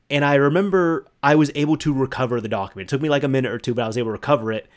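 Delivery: ordinary delivery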